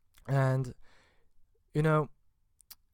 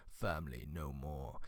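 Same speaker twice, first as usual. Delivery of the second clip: much lower and softer, deep gravelly trailer voice; mock movie-trailer voice; 10 semitones lower, 11 dB quieter than usual. Joking about Fast and Furious family theme, mock deep trailer voice. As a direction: intone it like a solemn action-film hero.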